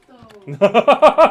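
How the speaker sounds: loud drawn-out tease; teasing. Acted. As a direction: stretch it out teasingly.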